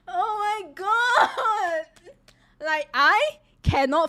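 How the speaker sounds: high, loud and slow through laughter; dragging words out between laughs; real helpless laughter; laughing fit. Real, not acted.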